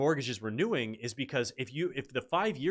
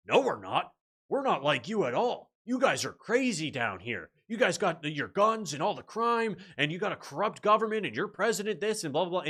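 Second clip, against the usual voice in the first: high, emphatic retold telling-off; mock indignant boasting; 6 semitones higher than usual. Canadian retelling how he told off an American woman, mock indignant. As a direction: imitate a man smugly telling someone off, pitch climbing.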